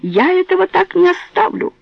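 high, shrill outraged cry; shouting. Acted. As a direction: yell in fury. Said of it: "кричала она на весь магазин".

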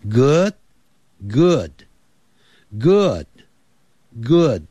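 good repeated very slowly, the final d light and held; drilled slowly. Shown as a demonstration. Teacher drilling good for parents and kids. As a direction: stretch out good with a barely voiced d. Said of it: "那个的的音很轻".

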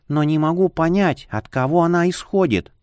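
uneasy witch voice; uneasy, half-sighing; whispery telepathic voice. A narrator's reading. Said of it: "ответила Снеженика". (встревоженный голос колдуньи)

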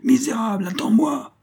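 very high-pitched threatening cry; hurlement. A narrator's reading. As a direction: yell high after a fleeing villain. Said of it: "hurla le Tarasconnais". (cri aigu de menace)

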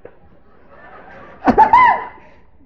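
loud, high burst of laughter; high shrieking laugh. Real, not acted. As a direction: cackle at the joke. Big laugh at own joke. (carcajada aguda)

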